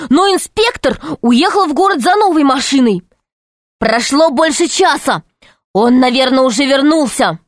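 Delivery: high, pressing warning; urgent boy warning. A narrator's reading. Boy warning of returning inspector. (мальчишка тревожно предупреждает, высоко)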